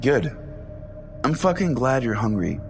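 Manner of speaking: ordinary delivery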